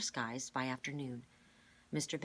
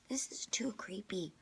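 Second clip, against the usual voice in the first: uneasy hushed whisper; nervous whisper; 6 semitones higher than usual. A narrator's reading. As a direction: whisper it, spooked by the idea. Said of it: "glancing nervously behind him".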